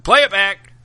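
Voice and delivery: shouted command; loud call. Pretend. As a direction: call out loudly. Calling for replay.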